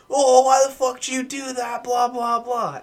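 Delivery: imitating a furious roommate; mock angry yell. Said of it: "all you hear is".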